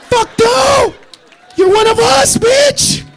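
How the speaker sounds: very high, loud yell; exultant shout. Acted. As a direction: scream it as a gleeful punchline. Shouted climax to the bit.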